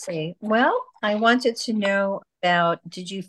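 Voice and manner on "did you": ordinary talk, nothing marked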